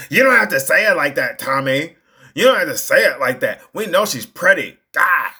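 acted-out indignant reprimand; exasperated scolding. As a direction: scold a friend who just embarrassed you, fast. Voices companion scolding Tommy at movie.

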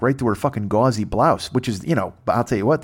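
normal talking voice

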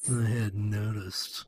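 soft, slow, smirking reply; smug smirk. Retold or imitated. Prowl brushing off Optimus, smug smirking drawl. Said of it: "with the fucking douchiest, shit-eatingest smirk ever".

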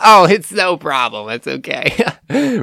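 high, gushing, too-sweet reassurance; over-the-top nice customer. Acted. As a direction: insist brightly, way too nicely. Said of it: "kind of over the top, too nice".

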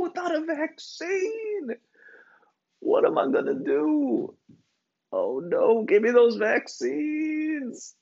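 high, whiny mock panic; panicked whiny voice. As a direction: wail it like a desperate fan. Panicking fan mock whine.

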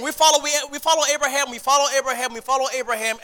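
fast, mocking sing-song insistence; mocking crowd quote. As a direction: parrot them back quickly, a bit mocking. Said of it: "They were saying".

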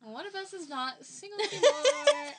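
laughing through the news. Real, not acted. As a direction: giggle while announcing. Announcing big news, laughing.

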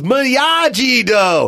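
very slow, drawn-out "Miyagi Do" shout; mock movie shout. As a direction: stretch every syllable like the movie kid. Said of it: "when he goes".

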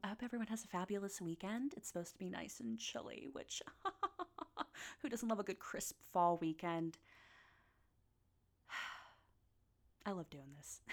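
soft, sleepy outro; fading softly. Real, not acted. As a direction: wind down to near silence.

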